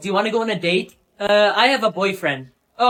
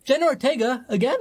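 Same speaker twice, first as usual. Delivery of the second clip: normal talking voice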